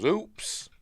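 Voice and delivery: high, rising little oops; acted oops. Film retelling of the librarian's mishap, high mock oops. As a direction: pitch it up sharply at the end. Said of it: "And she goes".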